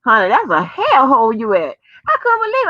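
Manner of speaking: ordinary delivery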